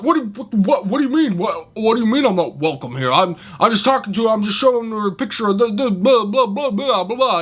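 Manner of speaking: high, fast, flustered rambling; mock flustered backpedaling. Worker mocks the customer stammering excuses when told to leave, high and flustered. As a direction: play him as a caught creep stammering excuses. Mocking the caught man backpedaling.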